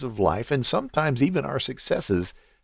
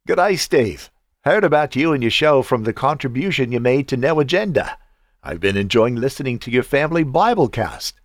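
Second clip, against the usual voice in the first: attempted Australian accent, pitched up in places; terrible Aussie accent; 3 semitones higher than usual. Podcast host reading a Melbourne listener's email, in an attempted Aussie accent. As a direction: put on an amateur Aussie accent. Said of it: "sorry for the probably terrible Aussie accent".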